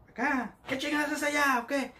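angry yell. Retold or imitated. Soldier scolding the recruit in the wrong line, yelling.

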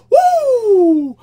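loud high whoop; celebratory woo. Real, not acted.

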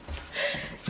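soft unvoiced giggle; breathy giggle. Real, not acted. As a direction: laugh under her breath. Laughing at puppy.

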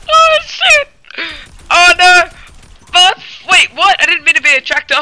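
high panicked shouts; being chased. Real, not acted. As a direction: squeal in frantic alarm while fleeing. Panics while fleeing in game.

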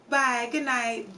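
ordinary delivery

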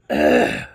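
deep pained groan from a burning throat; real strained groan after the heat hits; low groan. Real, not acted. Burning throat from sour pepper.

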